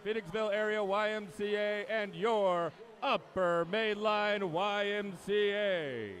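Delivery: big, drawn-out PA announcer welcome; formal announcer's voice, stretching the key words; meet announcer. Acted. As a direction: slowly roll out each phrase like an arena announcer. Announcer opens swim meet.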